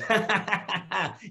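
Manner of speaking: high mocking chuckle; a smug little laugh, acted out; imitated knowing laugh. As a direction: laugh at him like you know better. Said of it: "He goes".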